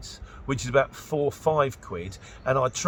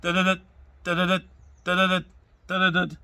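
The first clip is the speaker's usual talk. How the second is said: door chime imitation; 4 semitones higher, 4 dB louder than usual. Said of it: "it goes".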